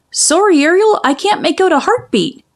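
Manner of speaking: high, quick, scatterbrained bird voice; silly seagull voice. Acted. Seagull telling the mermaid about the prince, high and quick. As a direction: chatter it like a daft seagull. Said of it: "said Scuttle".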